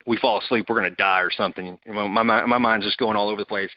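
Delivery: normal talking voice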